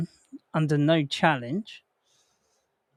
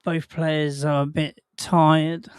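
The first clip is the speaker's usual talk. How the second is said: normal talking voice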